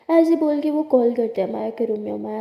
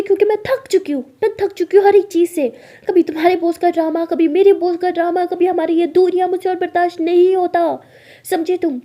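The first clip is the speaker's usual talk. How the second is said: loud, high, fed-up rant; shouting; 6 semitones higher, 7 dB louder than usual. Acted. Maya tells her partner she is tired of everything, shouting. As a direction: shout out a pile of grievances. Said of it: "तुम मुझ पे चिल्ला क्यों रही हो".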